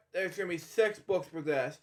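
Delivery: usual speaking voice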